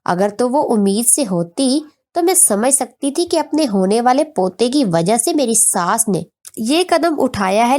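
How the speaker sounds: ordinary delivery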